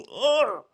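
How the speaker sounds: high, exasperated ugh; annoyed groan. Retold or imitated. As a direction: let out a fed-up groan. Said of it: "I just go".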